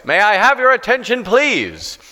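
loud announcement. Retold or imitated. Man on the subway grabbing everyone's attention, loud. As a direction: call out to the whole train car. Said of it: "announced in a very loud voice".

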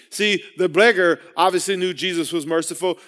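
ordinary delivery

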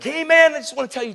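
high, loud call-out easing off in volume; retold eager greeting. Preacher hailing drunk college kids, retold eager greeting. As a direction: hail a stranger with sudden high enthusiasm.

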